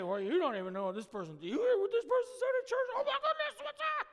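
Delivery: fast, high gossipy mock voice; mock gossip squealing over church news; gossiping grumbler. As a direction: dish the dirt like a scandalised churchgoer. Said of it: "we can say".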